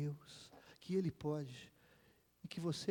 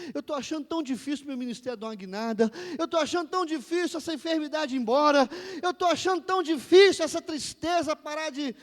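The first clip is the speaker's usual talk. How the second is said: loud, high pleading that builds; impassioned prayer preaching; 8 semitones higher, 12 dB louder than usual. Acted. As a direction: swell from plea into a fervent cry.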